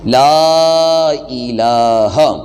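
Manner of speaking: لا الہ with the لام of لا drawn out long; slow Kalima recitation. Shown as a demonstration. Teacher recites لا الہ slowly to students. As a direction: stretch لا الہ slowly, lengthening each لام. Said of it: "لام کو تھوڑا سا لمبا کریں گے".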